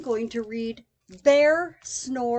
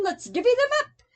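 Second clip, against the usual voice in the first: eager badger voice; 9 semitones higher, 4 dB louder, much faster than usual.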